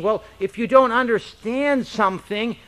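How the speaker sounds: usual speaking voice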